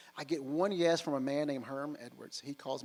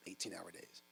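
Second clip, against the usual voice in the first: "eighteen-hour days" repeated much softer and lower, as if jotting it down; quiet echo; 8 semitones lower, 15 dB quieter than usual. Retold or imitated. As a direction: mutter "eighteen-hour days" back while writing.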